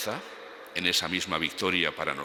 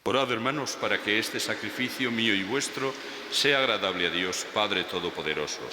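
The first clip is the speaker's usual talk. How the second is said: raised, solemn priestly intoning; liturgical intoning; 5 semitones higher than usual. Acted. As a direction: lift each phrase like a celebrant at Mass. Priest intones the offertory prayer. (entonación litúrgica solemne y elevada)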